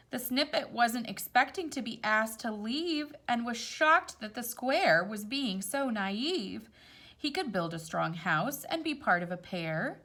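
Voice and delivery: gentle storybook read-aloud; rhyming story voice; reading to children. A narrator's reading. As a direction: read it like a picture book at bedtime. Reads picture book aloud.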